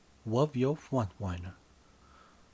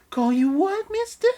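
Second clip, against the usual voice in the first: camp it up as the lover in a high falsetto; breathy partner; 11 dB louder than usual. Acted. Narrator voicing the lover in a high hammed-up falsetto.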